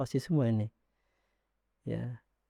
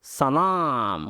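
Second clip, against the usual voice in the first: nasal voice. Shown as a demonstration. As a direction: put "Salam" in the nose, like sanam. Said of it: "Suara hidung".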